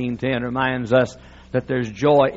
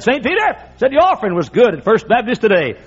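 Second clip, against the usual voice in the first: the Lord speaking; 6 semitones higher, 4 dB louder than usual. Retold or imitated. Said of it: "look down from heaven and said".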